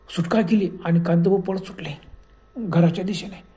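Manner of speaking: ordinary delivery, nothing marked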